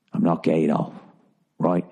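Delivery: low-pitched put-on British voice; halting, deep British old-man impersonation; bad British accent. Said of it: "I can't keep doing this accent".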